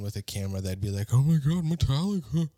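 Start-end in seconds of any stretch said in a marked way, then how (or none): 1.1-2.5 higher, louder gushing fanboy; mock starstruck fan. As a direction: imitate a fan losing it over the band. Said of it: "other grown men are like".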